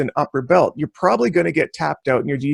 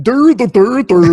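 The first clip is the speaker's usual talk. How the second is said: loud, higher-pitched dopey babble; put-on dumb voice, louder; mock dimwit; 6 semitones higher, 8 dB louder, slower than usual. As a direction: play a clueless dolt stammering. Mocking an idiot who can't write.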